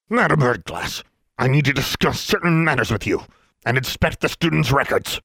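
pompous official. Acted. Inspector Brown rebukes the headmistress for laxity, pompous and high. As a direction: lecture her like a disappointed bureaucrat.

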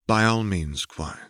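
usual speaking voice

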